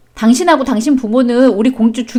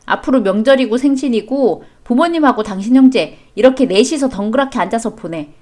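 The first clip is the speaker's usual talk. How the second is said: ordinary talk, nothing marked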